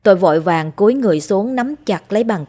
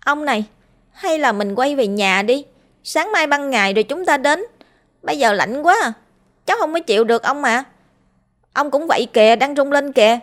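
put-on high, shivering boy's plea; pleading with grandpa, shaking from the cold; boy's voice trembling as he begs to go home; 7 semitones higher than usual. A narrator's reading. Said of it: "Tôi run giọng nói".

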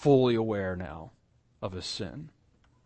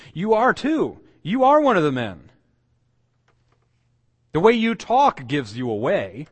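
loud, high-pitched accusing cry; insistent accusation; 5 semitones higher, 6 dB louder than usual. Acted. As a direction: press the accusation harder, pitch jumping up. Bystanders accusing Peter in retelling.

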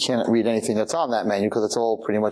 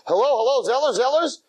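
flustered eagerness; 12 semitones higher than usual. Acted. Flustered man phoning tuxedo shop.

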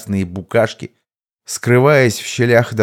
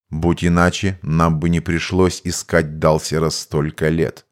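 low, even mage voice; composed mage; 5 semitones lower than usual. Acted. Mage explains to the commander how one evades his amulet, composed and low.

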